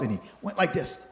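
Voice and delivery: plain reading voice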